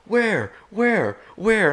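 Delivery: high, urgent repeated calls; searching wildly for the bear; frantic asking. Retold or imitated. Buddies scanning for the bear, high frantic calls. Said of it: "And they're like".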